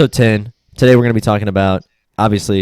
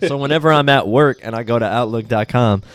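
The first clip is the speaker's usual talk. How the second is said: ordinary talk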